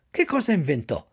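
plain reading voice

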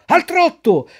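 very high, loud call; shouted command. Acted. Director shouts at Pinocchio to trot, loud. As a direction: bark the order across the ring. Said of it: "il direttore gridò".

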